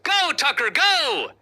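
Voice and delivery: high, rousing mock cheer; cheering fans. Host voicing fans urging on Tucker, excited cheer. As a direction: cheer him on like the crowd. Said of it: "the fans are cheering on Tucker".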